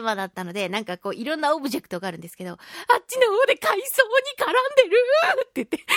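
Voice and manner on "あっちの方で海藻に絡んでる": alarmed call. Retold or imitated. Pointing out child in panic.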